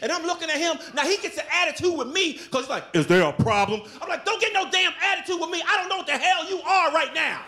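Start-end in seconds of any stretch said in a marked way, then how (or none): 2.9-3.8 clerk's attitude. Retold or imitated. 4.3-7.4 mock confrontation.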